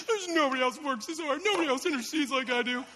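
whiny, hammed-up complaint; mock self-pity. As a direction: whimper that nobody appreciates you, half laughing. Mimics own past self-pity.

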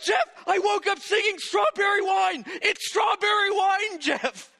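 high, insistent mock shouting; hammed-up high cry, easing on the final name; excited wife. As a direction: imitate a wife yelling her revelation. Retells wife's excited revelation.

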